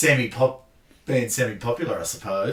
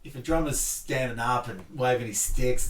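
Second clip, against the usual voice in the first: ordinary delivery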